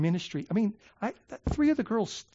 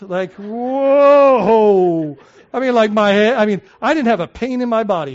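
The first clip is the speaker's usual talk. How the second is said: loud, high wonder, much louder; amazed exclamation; 5 semitones higher, 13 dB louder than usual. Retold or imitated. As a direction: relive the rush with a big "whoa". Recalling the drug's rush.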